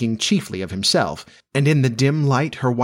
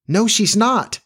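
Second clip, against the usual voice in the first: put-on high, insistent childish denial; boy's outcry; 11 semitones higher, slower than usual. A narrator's reading. Peter denying that Jane is Wendy's baby, high outcry. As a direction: deny it like an upset boy, high.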